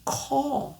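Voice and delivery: "call" with tongue still; right call. Shown as a demonstration. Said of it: "la bonne manière de le dire".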